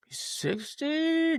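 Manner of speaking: high, drawn-out questioning guess; unsure guess. Real, not acted. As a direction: stretch it upward, doubting your own answer. Guessing his dad's age aloud.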